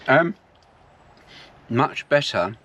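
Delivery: talking through a mouthful of food; words round a bite of pie; mouth full. Real, not acted. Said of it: "don't talk with your mouth full".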